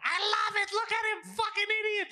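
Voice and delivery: high, gleeful mock shout; imitated yelling. Friend imitates mom enjoying embarrassing her son, high yelling. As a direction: crow it high, as a taunting mom. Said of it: "She'll yell it".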